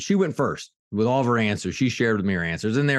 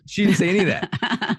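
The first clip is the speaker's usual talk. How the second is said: laughing; 10 semitones higher than usual. Real, not acted. Joke reveal after fake quote.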